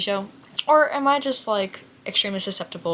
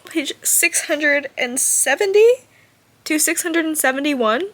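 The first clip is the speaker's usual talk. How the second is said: higher and louder, slowed by feeling; overcome; 7 semitones higher, 6 dB louder than usual. Real, not acted. Reader citing the page that moved her, higher and louder. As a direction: savour each number as if it hurts.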